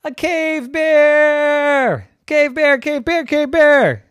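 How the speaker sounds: high, loud alarm, stretched then repeated; panicked shrieking. A narrator's reading. Maven warning of a cave bear, panicked high shrieks.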